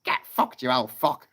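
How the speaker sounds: squawked parrot insult; sweary parrot. Acted. Parrot insulting the sergeant major, squawky voice. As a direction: croak it out like a rude parrot. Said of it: "And the parrot goes".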